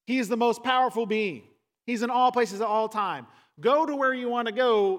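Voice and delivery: loud, high, rising sermon climax; preaching at full force. Acted. Pastor to congregation, a loud, rising proclamation. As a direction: proclaim God is everywhere to the congregation.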